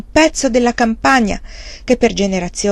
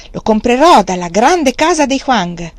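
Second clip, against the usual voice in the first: proud insistence; 4 semitones higher, 7 dB louder than usual. A narrator's reading.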